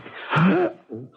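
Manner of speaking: sharp startled intake of breath; shocked gasp. Acted. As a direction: gasp at finding her collapsed. Finds woman on floor.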